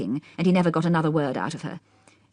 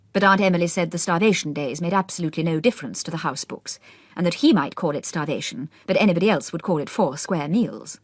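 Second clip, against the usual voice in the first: plain reading voice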